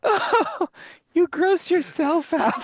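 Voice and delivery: high, loud giggling through the words; can't stop laughing; laughing hard. Real, not acted. Cohosts cracking up at the bad accent, laughing.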